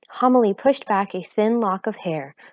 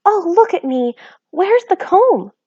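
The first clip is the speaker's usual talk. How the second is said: high, loud flustered fuss; flustered mother fussing over looks; 11 semitones higher, 7 dB louder than usual. A narrator's reading. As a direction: fuss in a hurry. Homily flustered.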